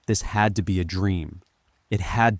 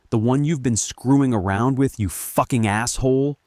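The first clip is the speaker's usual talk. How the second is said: raised pitch, heated accusation; angry accusing; 5 semitones higher, 4 dB louder than usual. A narrator's reading. Linda accuses her husband of cheating, angry. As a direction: throw angry questions at him.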